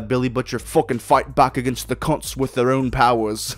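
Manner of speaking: a bad, hammy British accent; lightly done mock British accent; terrible British accent. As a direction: put on a clumsy British accent for this line. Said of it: "With my, uh, terrible British accent".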